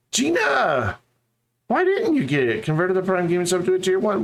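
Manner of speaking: high-pitched call-out to Gina; exaggerated high-pitched protest; mock outburst. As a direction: pitch it way up, playfully demanding an answer.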